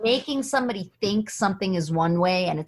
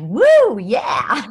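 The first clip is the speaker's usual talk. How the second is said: mock cheer; 2 semitones higher, 9 dB louder than usual. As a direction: whoop like it's great news.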